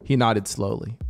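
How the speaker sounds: usual speaking voice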